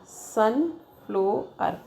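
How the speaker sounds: "sunflower" in slow pieces, sun, flow, er; "sunflower" with a clear break after sun and after flow; split into syllables. Shown as a demonstration. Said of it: "ये ऐसा".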